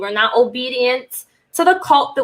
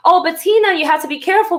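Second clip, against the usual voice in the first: much higher, pleading mock-mom warning; imitating fretful mom; 8 semitones higher, faster than usual. Mom warning her daughter Tina to be careful, high and pleading. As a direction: squeak it out like an anxious mother.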